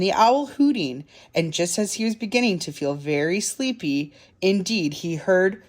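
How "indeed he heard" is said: ordinary delivery, nothing marked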